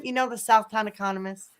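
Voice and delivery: usual speaking voice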